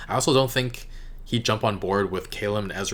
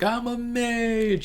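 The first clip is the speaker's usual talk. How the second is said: high, drawn-out excited yell; high-pitched triumphant cry, long on the last word; mock scream; 14 semitones higher, much slower than usual. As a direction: imitate a gleeful scream, stretching it out. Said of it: "he was just screaming".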